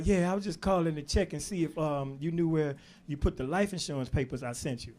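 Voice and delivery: put-on soft, weak old father; a hint of a frail, timid dad; shy. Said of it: "and he was saying".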